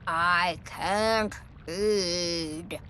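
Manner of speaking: very slow, low and pitiful; drawn-out, low sick-beast whine; sick dragon. Acted. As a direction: moan it like a dragon with a cold.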